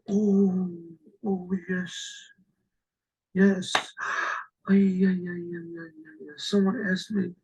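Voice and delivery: drawn-out oys, trailing off softly; dawning dismay. Retold or imitated. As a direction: act the sinking realization with long, halting groans. Man realizes with dismay he forgot.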